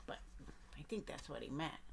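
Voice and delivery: ordinary delivery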